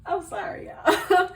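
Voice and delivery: 笑いながら謝る; laughing apology. Real, not acted. Apologising mid laughing fit.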